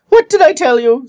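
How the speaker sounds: aggrieved. A narrator's reading. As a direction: complain as if proven right all along. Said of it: "in an aggrieved tone".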